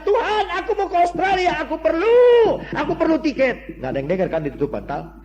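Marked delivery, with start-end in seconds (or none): 0.0-3.8 high, loud pleading shout, stretched at the peak; shouted prayer. Retold or imitated. Preacher re-enacting his prayer screamed into a pillow, shouted plea. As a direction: cry out to God, high and loud. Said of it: "Saya teriak".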